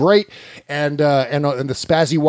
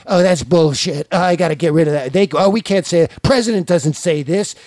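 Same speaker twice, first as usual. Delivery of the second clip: Trump impression; 3 semitones higher than usual. Imagined Trump redacting with Sharpie.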